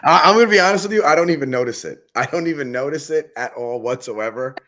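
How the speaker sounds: loud, high-pitched real laughing confession; laughing protest. Real, not acted. Fighter to host teasing him about swearing, laughing protest. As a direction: protest through laughter, voice jumping up.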